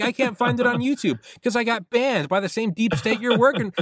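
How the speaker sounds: high, worked-up comic whine; mock outraged protest. Heated imagined quarrel over a banned episode, mock outraged. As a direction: complain with the voice pushed way up.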